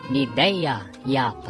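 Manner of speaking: normal talking voice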